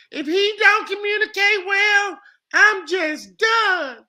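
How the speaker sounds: high put-on woman's voice; girl voice. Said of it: "How's my girl voice?".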